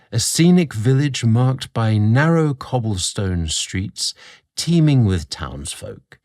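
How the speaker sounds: ordinary delivery, nothing marked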